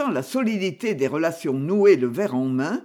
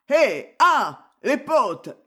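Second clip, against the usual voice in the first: put-on drunken call-out; 7 semitones higher, 4 dB louder, much slower than usual. A narrator's reading. Drunk calling his pals over.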